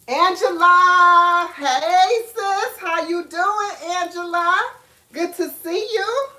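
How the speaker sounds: excited greeting. Real, not acted. Greeting a viewer by name.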